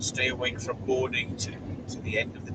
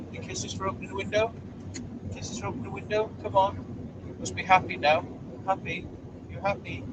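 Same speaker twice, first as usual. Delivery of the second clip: pet coaxing; 6 semitones higher than usual. Real, not acted. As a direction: wheedle a kiss from the dog, pitched up. Coaxing the dog for kisses.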